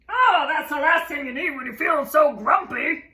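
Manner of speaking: loud, fretting; fussy friend voice. Acted. Norman fretting over Jim tripping, loud. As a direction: fuss over him.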